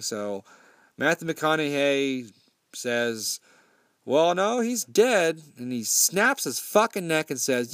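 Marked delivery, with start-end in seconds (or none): 4.0-5.4 insistent mock menace. Tow truck driver insists to Sean, mock menacing. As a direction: press it home like a threat. McConaughey insists before killing him.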